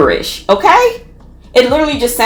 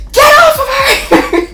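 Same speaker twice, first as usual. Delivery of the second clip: much higher, laughing mock yell; mock shouting. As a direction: roommate defending her, yelled through laughter. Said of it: "my roommate was like".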